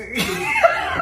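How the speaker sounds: very high laughing shriek. Real, not acted. Laughing at the cake.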